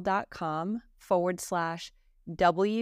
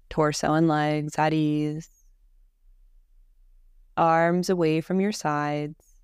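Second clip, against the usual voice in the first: guided meditation; 3 semitones lower, 6 dB louder than usual. Real, not acted. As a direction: guide them calmly through each breath, unhurried. Leads a guided Yoga Nidra.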